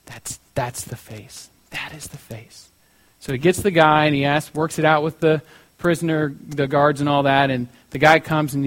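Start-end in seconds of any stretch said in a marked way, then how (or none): 0.1-2.7 slow, low and very soft; hushed realization. Retold or imitated. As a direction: let the discovery sink in quietly. Painter finds his Judas face.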